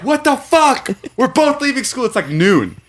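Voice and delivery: high-pitched, thrilled astonishment; retold excited disbelief. Running into a schoolmate out of school, excited disbelief. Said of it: "I'm, like, really excited about it".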